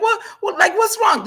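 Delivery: put-on teenage daughter, upset, asking what's wrong. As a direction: imitate a panicked daughter asking. Crying daughter asking mother.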